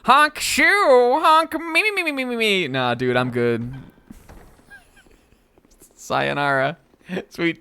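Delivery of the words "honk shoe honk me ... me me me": high cartoon snore, honk mimimi; snoring. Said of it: "What do you think she would say?".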